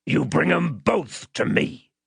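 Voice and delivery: menacing man. Acted. Man ordering the child as he tilts him, menacing. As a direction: spell out the order slowly and menacingly.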